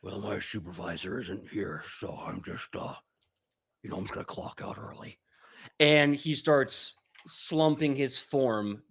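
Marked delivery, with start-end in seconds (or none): 0.0-5.2 softer, lower mumble of a slacker monster; bored creature mumbling. Acted. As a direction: mutter it like a bored worker sneaking off. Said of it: "he goes".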